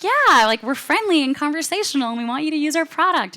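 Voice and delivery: high-pitched, hammed-up enthusiasm; mock eager. American writers defending their friendly tone, mock eager and high. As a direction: play the keen, friendly American team. Acted eager reply to the manager.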